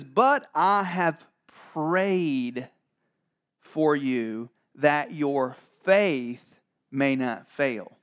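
slow, weighty words with long pauses; measured pronouncement, drawn out; solemn Bible reading. Acted. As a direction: deliver it gravely, phrase by phrase. Jesus' answer read dramatically.

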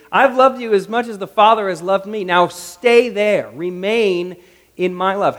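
loud, commanding preaching delivery; emphatic proclamation. Acted. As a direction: proclaim it forcefully, drawing out the command. Preaches Jesus's words emphatically.

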